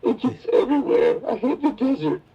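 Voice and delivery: high, whining sand complaint; whiny tantrum. Retold or imitated. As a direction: sulk like a toddler. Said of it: "a temper tantrum".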